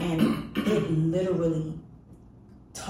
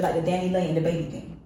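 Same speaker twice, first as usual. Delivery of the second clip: ordinary delivery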